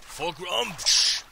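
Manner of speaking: shouted rallying cry; orc battle cry. Acted. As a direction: roar it as you charge.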